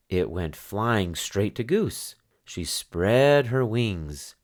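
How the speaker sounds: ordinary delivery, nothing marked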